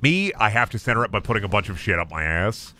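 normal talking voice

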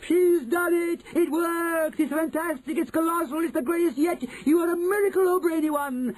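very high, ecstatic witch crowd; high-pitched, jubilant screeching praise; yelled acclaim. A narrator's reading. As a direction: cheer each phrase in adoring rapture. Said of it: "yelled the audience".

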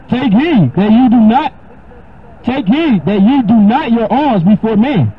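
scripture reading. Acted. Preacher reading Matthew to his listener, solemn and slow. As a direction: lift the voice and read the verse with weight.

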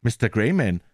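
usual speaking voice

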